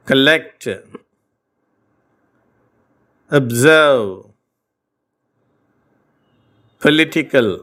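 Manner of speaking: கலெக்ட், அப்சர்வ், பொலிட்டிக்கல் said slowly with a light short a for the o; light a sound demo. Shown as a demonstration. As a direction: demo each word alone, a light a where o is written. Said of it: "இந்த உச்சரிப்பு மெல்லிய அ". (ஓ எழுத்தை மெல்லிய அ ஒலியாக உச்சரித்தல்)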